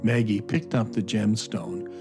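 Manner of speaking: normal talking voice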